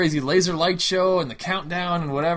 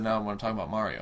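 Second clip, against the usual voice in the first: quick, lower, no-nonsense turn; deadpan, businesslike; 5 semitones lower, 5 dB quieter, much faster than usual. Retold or imitated. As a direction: say it straight, no joke. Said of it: "said it for real".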